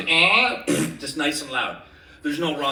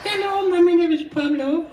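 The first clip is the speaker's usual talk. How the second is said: high, louder squeaky bird voice; Pablo's voice; 11 semitones higher, 5 dB louder than usual. Shown as a demonstration. Host repeats the crow voice, higher and louder. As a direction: squawk it like a little crow. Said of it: "his voice sounds like this".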